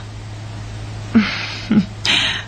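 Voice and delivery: soft breathy giggle, half unvoiced; giddy giggle. Girl reading Keith's sweet text, giddy giggle. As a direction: giggle to yourself, flattered by the text.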